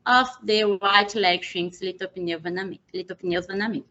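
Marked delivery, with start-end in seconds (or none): none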